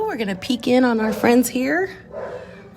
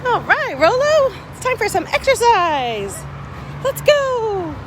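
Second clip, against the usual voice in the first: high sing-song call to a dog; rally the dog cheerfully; talking to a dog; 9 semitones higher, slower than usual. Real, not acted. Calling dog out.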